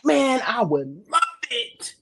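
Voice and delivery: fired-up shouting; excited shout. Real, not acted. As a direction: yell it with glee. Excited hype.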